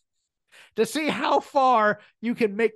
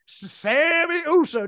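SAMMY stretched out high, then USO; imitated grand, lingering announcement of "Sammy Uso"; Roman's emphatic delivery; 7 semitones higher, 4 dB louder, slower than usual. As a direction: draw out "Sammy Uso" slowly and higher. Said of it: "just the way he delivers that".

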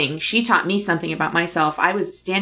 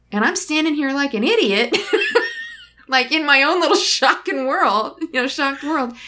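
higher, slowed, a self-mocking laugh as she scolds herself; 6 semitones higher than usual. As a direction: chuckle at how foolish she felt.

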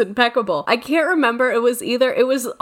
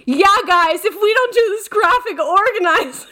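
high, louder mock panic; mocking the teacher; 9 semitones higher, 4 dB louder than usual. Student in front row parodying the teacher to the class, high mock panic. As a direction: parody her frantic nagging. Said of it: "started making fun of me".

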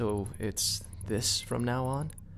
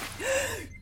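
sharp gasp. Acted. Patient suddenly stirs.

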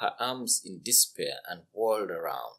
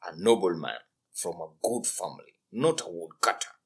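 frowning king; 5 semitones lower than usual. Acted. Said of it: "frowned the king".

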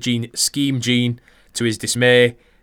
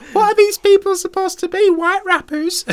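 very high, louder mock falsetto; bewildered woman; 6 dB louder than usual. Co-host left alone on the podcast, baffled falsetto imitation. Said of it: "she was just like".

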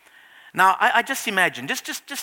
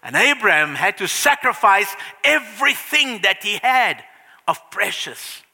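ordinary talk

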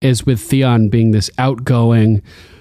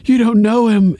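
much higher, frightened pleading; mock scared Theon; 11 semitones higher than usual. Podcaster acting paranoid Theon warning Sansa, high and scared. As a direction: squeak out the warning in fear. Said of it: "And he's like".

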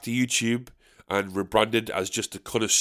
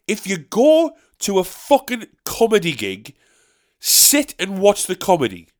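exasperated rant; 7 semitones higher, 5 dB louder, slower than usual. Real, not acted. Ranting at audiences.

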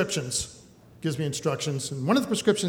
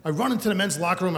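ordinary talk, nothing marked

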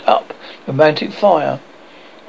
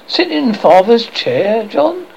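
shocked, rising question, slow; aghast at the very idea; Wendy appalled at John; scandalized; 6 semitones higher, slower than usual. A narrator's reading. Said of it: "Wendy was scandalized".

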